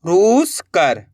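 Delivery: रूस कर split, with रूस stretched and rising, then कर falling; teacher's slow read-back of रूस ... कर in two pieces; word in two parts. Shown as a demonstration. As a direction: draw out रूस, pause, then land on कर. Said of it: "तो मिलके बन गया".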